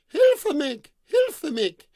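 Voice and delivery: high, loud cry of a damsel; maiden's shout for help. Acted. A maiden calls from her tower, crying out for help. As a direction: shriek for help from far away. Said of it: "he thought he heard her shout".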